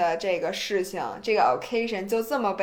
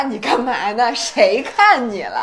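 mock indignant denial; 9 dB louder, slower than usual. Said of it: "说".

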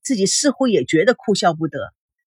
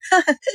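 a short high burst of laughter that falls away; hearty laugh; 10 semitones higher than usual. A narrator's reading. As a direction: let out one big delighted laugh. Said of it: "吴莹开怀大笑".

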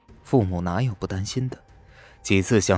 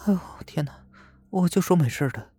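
breathy whispered relief, then higher; relieved; 9 semitones higher, faster than usual. A narrator's reading. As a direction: sigh it out, saved. Lin Wei relieved he lives.

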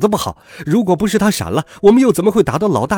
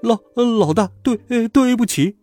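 high, stammering apology; scared, halting, pitched way up; trembling with fear; 8 semitones higher than usual. A narrator's reading. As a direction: stammer timidly to an angry boss. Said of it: "战战兢兢地说".

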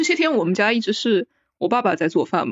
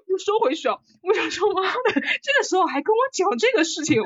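half laughing, half mock sobbing, pitched high; laugh-crying mock rant; 8 semitones higher than usual. Recalling her mother's marriage nagging, mock sobbing through laughter. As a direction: whine it through laughter as if in tears. Retelling tears stopping at mom's nagging.

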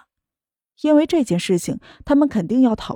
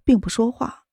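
plain reading voice

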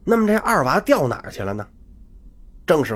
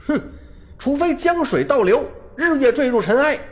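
much higher, defiant furious cry; enraged; 10 semitones higher than usual. A narrator's reading. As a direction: fling the vow out in boyish fury. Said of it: "勃然大怒".

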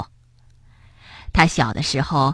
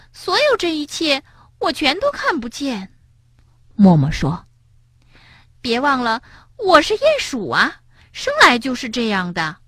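much higher, gentle mole voice; little mole; 5 semitones higher than usual. Acted. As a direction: play the small mole asking kindly. Mole character voice.

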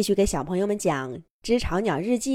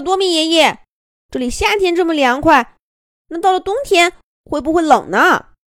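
excited little bird; 9 semitones higher, 8 dB louder than usual. Acted. Excited little bird asks grandpa.

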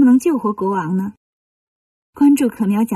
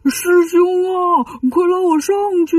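calling out; 7 semitones higher than usual. A narrator's reading. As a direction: call up to someone far above, stretching the words. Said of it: "赶紧游出水面喊".

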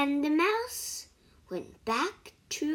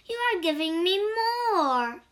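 annoyed mouse; 7 semitones higher, 5 dB louder than usual. Acted. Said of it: "said the mouse".

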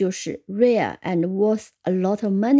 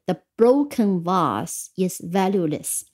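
slow model sentence; 3 semitones lower than usual. Shown as a demonstration. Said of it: "或者可以讲".

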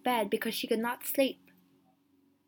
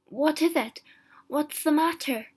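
higher, startled questioning; raised, urgent bedside questions; alarmed cry; 5 semitones higher, 5 dB louder than usual. A narrator's reading. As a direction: ask in sudden alarm.

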